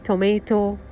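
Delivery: ordinary talk, nothing marked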